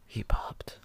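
gasping, hushed gossip whisper; breathless scandalized whisper. Pretend. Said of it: "like, a kid's gossip show?".

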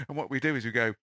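ordinary delivery

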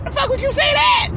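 shocked, indignant squeal of a question; outraged disbelief. Retold or imitated. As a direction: demand why she said it. Outraged at the stranger's remark.

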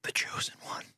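hushed sinister whisper; creepy whisper. Acted. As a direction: breathe it like a cult shopkeeper. Shopkeeper line in scene.

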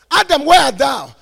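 preacher's loud, high call as God; screamed call. Acted. God calling for hiding Adam to hear, loud shouted call. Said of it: "God has to scream for Adam to hear".

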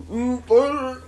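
high, loud exerted grunt; mock straining noises. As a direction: groan like climbing stairs is a huge effort. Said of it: "noises fat people make when they try to get upstairs".

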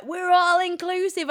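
high, loud mock-mum cry; exasperated mum. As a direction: wail it like a fed-up mum. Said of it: "your mum's like".

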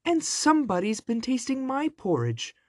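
shrill little bear voice, very high; high-pitched baby bear squeal; squeaky baby bear. A narrator's reading. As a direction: squeak it like a tiny indignant bear cub. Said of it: "squealed the baby bear".

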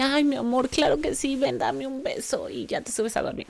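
high, sugary doting mother; mock loving mom. What mom didn't say to son at bedtime, mock tenderness. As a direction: overdo the sweet, indulgent mommy voice. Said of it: "no fue amorosamente que le dije".

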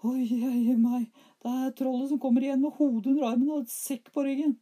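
put-on very high, loud frightened boy. A narrator's reading. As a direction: squeal it like a scared child. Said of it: "kom inn igjen og sa".

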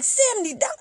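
high-pitched disbelieving question; retold sticker shock; incredulous. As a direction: shoot up high in disbelief at the price. Balking at the quoted price.

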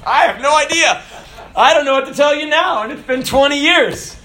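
high, loud laughing reply. Real, not acted. Joking to the congregation about crying wives, laughing. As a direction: laugh through the answer.

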